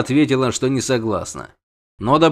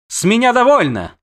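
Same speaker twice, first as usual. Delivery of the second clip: high, loud siren's cry; melodious exclamation; 11 semitones higher, 5 dB louder than usual. A narrator's reading. Siren has had enough, melodious exclamation. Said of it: "певуче воскликнула Шьенас".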